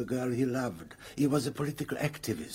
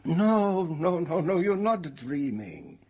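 insistent; 5 semitones higher, 4 dB louder than usual. Acted. Ghost insists she is awake.

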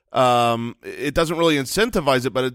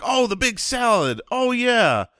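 high, delighted sudden remembering; oh yeah, recalling it fondly; surprised recognition; 10 semitones higher than usual. Retold or imitated. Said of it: "you're like".

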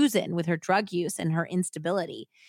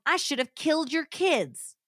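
higher, louder, venomous; hysterical threat; 8 semitones higher, 4 dB louder than usual. A narrator's reading. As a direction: spit the threat at him.

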